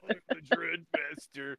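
slow, soft, drawn-out vampire larper; drawn-out, mock-ominous title; mock dark lord. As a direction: play a hammy vampire announcing his title. Mock vampire larper naming himself.

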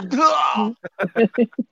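high shrieking laugh; loud laugh. Real, not acted. Cracking up at his own joke, high and loud. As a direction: let a big whooping laugh out.

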